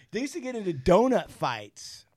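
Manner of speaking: ordinary delivery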